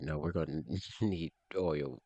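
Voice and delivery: ordinary delivery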